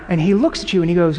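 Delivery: usual speaking voice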